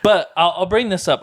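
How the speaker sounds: ordinary delivery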